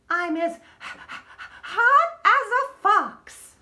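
mock hot stammer. Stutters then hot.

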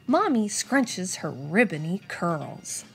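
plain reading voice

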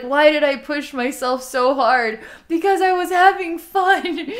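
high, loud self-scolding wail with a laugh; mock exasperation. Voices the sledder in pain.

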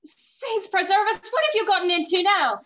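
high, loud cry of dismay; alarmed exclamation. Acted. Mother sees son's visitors, alarmed exclamation. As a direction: exclaim as an exasperated, shocked mother.

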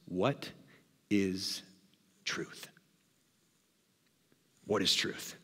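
low, hushed scoff with deliberate pauses. Acted. Pilate scoffing at Jesus' talk of truth, scoffing. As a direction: scoff under the breath, very soft and low. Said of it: "standing up and scoffing".

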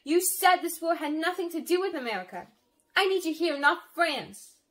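high, loud, pleading protest; upset protest. A narrator's reading. Sister begs enlisting brother to stay, loudly upset. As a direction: argue hotly to keep him here.

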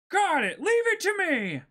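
put-on high, loud eager boy; cheerful boy hero. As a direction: shout it like a brash young pirate. S0 voicing Luffy in game.

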